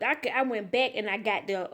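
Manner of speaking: usual speaking voice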